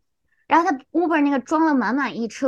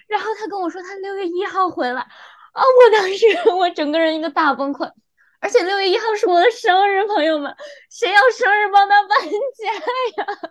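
breaking down; 7 semitones higher than usual. Real, not acted.

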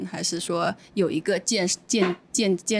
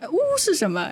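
high-pitched shocked question; retold reaction of astonishment; 9 semitones higher than usual. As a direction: draw out a startled "呜" up high. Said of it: "震惊地说".

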